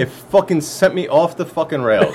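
heated rant. Real, not acted. Ranting about toilet paper.

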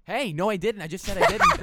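high, loud protest; defensive. Real, not acted. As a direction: protest loudly that you didn't. Defending against threat accusation.

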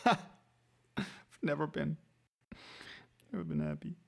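choppy repeated words from a bad connection; breaking up on a call; buffering stutter. Real, not acted. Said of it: "you cut out there for me".

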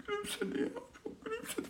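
high, strained, teary crying voice; hammed-up weeping through the words; mock blubbering sob. New father retelling his sobbing at the hospital, high mock cry. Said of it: "ben başladım hüngür şakır ağlamaya".

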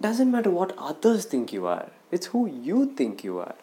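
usual speaking voice

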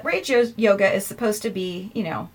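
ordinary talk, nothing marked

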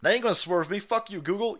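ordinary talk

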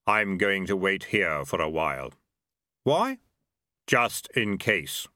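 slow, hollow voice of Death; lightly voiced grim reaper, unhurried. A narrator's reading.

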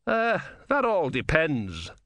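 wary, drawn-out reply; cautious. A narrator's reading. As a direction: feel your way into a careful answer. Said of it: "said the philosopher cautiously".

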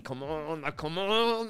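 drawn-out, climbing come-on; acted-out road frustration; exasperated urging. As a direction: build impatience, pitch rising sharply. Impatient behind a slow driver.

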